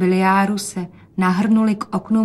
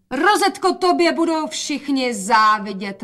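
mother gushes loudly for father to hear; calling out; 6 semitones higher, 4 dB louder than usual. A narrator's reading. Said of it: "volala matka". (hlasité zvolání)

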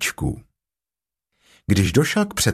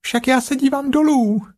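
very high piglet call; high-pitched, acted calling out; loud Piglet exclamation. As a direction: cry out in a tiny high voice. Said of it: "zvolalo prasátko hlasitě".

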